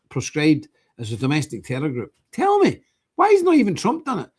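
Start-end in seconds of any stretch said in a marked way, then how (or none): 2.3-4.3 high, loud outraged challenge; indignant demand. Real, not acted. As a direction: demand an answer, voice shooting up. Ranting demand to viewer.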